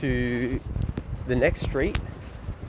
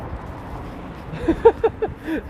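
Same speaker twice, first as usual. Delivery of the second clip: breathless silent laugh, much higher; high wheezy laugh; 13 semitones higher than usual. Real, not acted. Streamer cracking up at own rant, wheezing laugh. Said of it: "laughs at himself".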